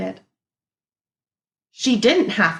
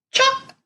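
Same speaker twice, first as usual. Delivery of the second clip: chop sound; 3 dB louder than usual. A narrator's reading. Sound word in read-aloud.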